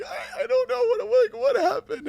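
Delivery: very high, loud, tearful wail; crying voice. Retold or imitated. Retelling how he cried to a weeping fan, high and loud. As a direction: break into sobs, bewildered. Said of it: "I'm like".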